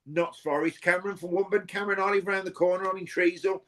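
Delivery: normal talking voice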